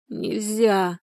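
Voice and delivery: weak, drawn-out moan. A narrator's reading. Людмила rejects going to a doctor, moaning. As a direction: moan the refusal helplessly. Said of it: "простонала Людмила".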